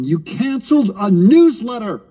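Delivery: normal talking voice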